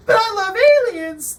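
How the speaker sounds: mock gushing believer. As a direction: squeal it like a starry-eyed alien fan. Mocking a believer.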